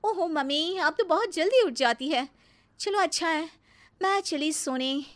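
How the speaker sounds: high-pitched, airy nonchalance; breezy bahu. Acted. Bahu back at dawn tells mother-in-law she's off to sleep, breezy. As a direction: brush past her without a care.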